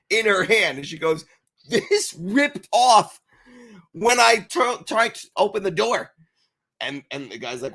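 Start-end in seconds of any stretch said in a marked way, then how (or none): 1.7-6.1 mock angry complaint. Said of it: "She comes up angry".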